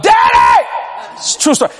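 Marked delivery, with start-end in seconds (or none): 0.0-0.7 very high, loud cry for daddy; hollering. Retold or imitated. Teen hollers to his dad across the road, loud. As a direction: holler it as loud as you can. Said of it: "Hollering that loud".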